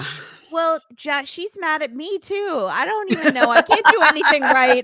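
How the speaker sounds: high-pitched helpless whine; hammed-up sad sack throwing up his hands; hapless Charlie Brown type. [name] defending himself to Kara, mock helpless. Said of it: "He's very like Charlie Brown".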